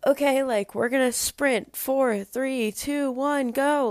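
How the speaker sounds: demonstrating how she used to cue a sprint; her old talking voice. Shown as a demonstration. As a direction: call the sprint count high and bright. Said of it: "I was talking like this".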